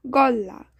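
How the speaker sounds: গল্লা with a long doubled l and no d; assimilated double consonant. Shown as a demonstration. Teacher showing a dropped sound, গল্লা pronounced golla with double l. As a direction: say গল্লা as "gol-la", holding the l. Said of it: "দাটা পুরোটাই বাদ যেয়ে গটা দুইবার উচ্চারণ হবে".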